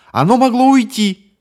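very high, loud desperate cry; desperate scream. Acted. Bonnie insists the monster left, desperate scream.

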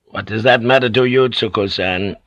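ordinary delivery, nothing marked

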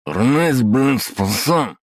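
slurred, high and loud, slow; garbled words like porridge in the mouth; mouth full of mush. Acted. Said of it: "Говорил тот будто с кашей во рту".

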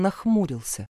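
usual speaking voice

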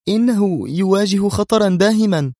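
high-pitched, anxious warning; trembling alarm. A narrator's reading. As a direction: warn as if the voice might tremble. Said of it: "ارتجف صوت منى وهي تقول". (بصوت مرتجف)

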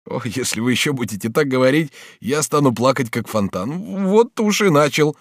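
high voice on the verge of crying; near tears. A narrator's reading. Bonifas warns he will cry like a fountain, tearful. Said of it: "Бонифас действительно зарыдал".

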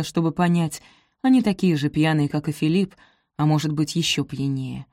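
ordinary delivery

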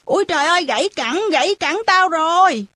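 hammed-up, high-pitched cry of alarm; fake pained yell. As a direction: fake an injury to lure someone out. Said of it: "giò hét ầm lên".

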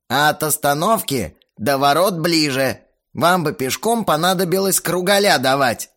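a gruff caretaker giving orders; creaky watchman voice. A narrator's reading. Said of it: "тот же скрипучий голос".

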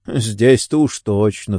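ordinary delivery

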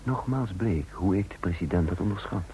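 measured, reflective storytelling voice; calm first-person narrator; story narration. A narrator's reading. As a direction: tell it looking back, unhurried. Narration between dialogue lines.

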